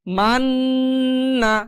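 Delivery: মান্না with the ন্ন hummed long; slow Quranic recitation; held nasal ghunnah. Shown as a demonstration. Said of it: "গুনগুন আওয়াজ".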